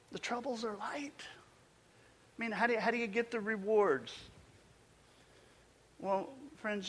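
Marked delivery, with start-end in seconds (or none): 0.1-2.5 hushed, unvoiced aside; whisper. Preacher confiding to the congregation in a whisper. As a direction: lean in and whisper it.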